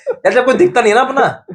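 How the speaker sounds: laughing while telling. Real, not acted. Remembering lonely Dubai days, laughing. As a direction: laugh through the words. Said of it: "laugh heard".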